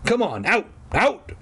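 high, stern dad commands; ordering out. A narrator's reading. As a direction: shoo the dogs out sharply.

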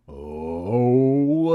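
long, loud rising moan; strange moan. A narrator's reading. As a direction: let out a drawn-out eerie moan. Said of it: "a strange moan escaped".

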